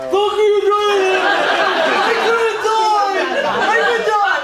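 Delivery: loud, high curse dissolving into laughs; mock the stung husband while laughing; laughing quote. Husband cursing teller, voiced through her laughter. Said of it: "And he's like".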